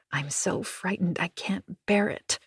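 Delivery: ordinary delivery, nothing marked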